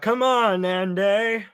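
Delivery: high, drawn-out heckler call; imitated crowd yell. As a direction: call it out like a rowdy fan in the stands. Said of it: "crazy guy in the crowd yells out".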